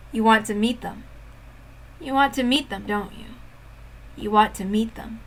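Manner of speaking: soft, insinuating villain voice; sinister taunt. Acted. Villain taunting the grieving girl, soft sinister voice. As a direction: coax her cruelly.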